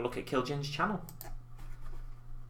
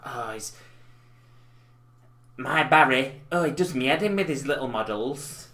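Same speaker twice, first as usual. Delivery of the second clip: a light take on a fed-up mum, louder on my Barry; his sister's voice; 4 dB louder than usual.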